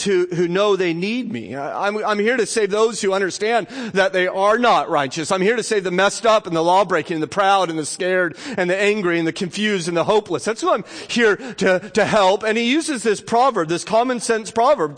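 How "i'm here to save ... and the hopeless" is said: ordinary talk, nothing marked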